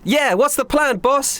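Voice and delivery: high-pitched eager sidekick voice; Tiger. Acted. Tiger spins to Damien for the plan, high character voice. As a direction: pipe up high to the boss. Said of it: "to Damien and goes".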